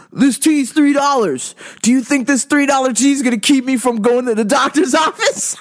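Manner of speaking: hammed-up outrage at the cheap tea; incredulous customer.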